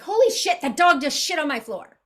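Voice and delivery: a sudden high yelp on holy, then blunt; real me, startled. Retold or imitated. Showing followers her real self, a startled high blurt. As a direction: blurt it out, caught off guard. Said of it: "I use my voice".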